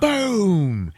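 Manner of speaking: high, loud mock explosion; loud boom. Telling a friend about the bomb, a loud shouted boom. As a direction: bark out a cartoon boom.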